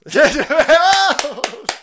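big rising burst of laughter; loud laugh. Real, not acted. Laughing at the quote.

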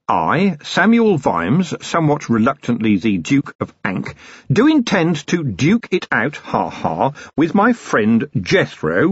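formal dictation. Acted. Commander dictates a statement to his manservant, slow and formal. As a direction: spell it out grandly for the note-taker.

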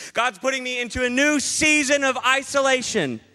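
high, loud gushing mock voice; mock introvert glee. Preacher mocking introverts, high gleeful voice. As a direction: gush like a thrilled introvert. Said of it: "all the introverts are going".

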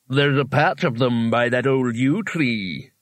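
slow, drawn-out creature voice; talking rock. Acted. Rock directs Rincewind to onions, slow otherworldly voice. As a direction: stretch the words out as a helpful stone would. Rock's mysterious voice answers Rincewind.

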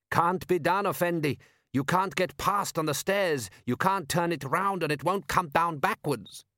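high, slow, emphatic pronouncing; know-all donkey expert. A narrator's reading. Donkey expert telling the patrician it is hopeless, know-all. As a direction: pronounce it impossible, pitched high.

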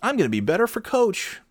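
high mock dopey voice; dopey player. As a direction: play a clueless player agreeing. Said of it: "Is like".

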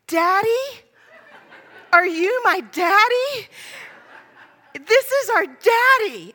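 put-on high, loud, drawn-out child voice; imitated little kids. Twins hail a stranger in the aisle, high eager kid voice. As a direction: play eager toddlers calling out to Daddy.

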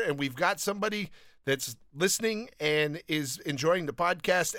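usual speaking voice

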